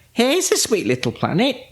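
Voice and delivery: high-pitched, delighted character voice; cheery witch. A narrator's reading. Winnie choosing a planet for the picnic, high cheery voice. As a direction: lift the voice high, pleased with the find.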